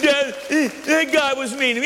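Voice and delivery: high, mock-pitiful tattling; whining child. Child tattles to father about a mean guy, high mock whine. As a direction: play a kid whimpering to dad. Preacher plays himself whining to dad.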